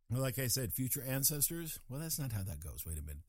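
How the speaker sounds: normal talking voice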